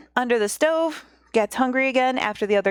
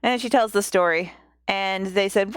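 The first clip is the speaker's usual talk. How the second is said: ordinary delivery, nothing marked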